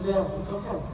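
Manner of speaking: soft, low, gentle reassurance; reassuring son. Acted. Son comforting his father after the trip is cancelled, soft and gentle. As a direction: ease your dad's guilt softly.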